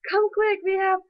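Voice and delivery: a hint of excited family calling them over; eager relatives. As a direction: lure them in like family waving from the door. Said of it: "they're like".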